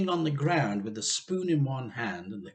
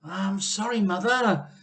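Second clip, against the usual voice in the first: higher, flustered apology; apologetic Missus Cranky; 8 semitones higher, 7 dB louder than usual. A narrator's reading. Missus Cranky apologises nervously to her mother. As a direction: hurry to appease her mother. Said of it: "Missus Cranky said".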